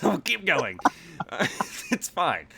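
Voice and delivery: over-the-top Cockney, half laughing; exaggerated Cockney. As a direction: push on in thick Cockney while amused. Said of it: "even further exaggerate my own accent".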